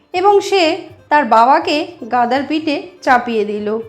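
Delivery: plain reading voice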